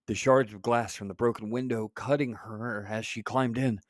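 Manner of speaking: ordinary delivery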